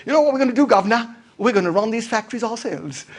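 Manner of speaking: playful, badly faked British working-class accent; terrible British worker accent. Workers announcing a factory takeover, bad British accent. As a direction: put on a cheeky British "guvnor" accent. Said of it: "sorry, terrible accent".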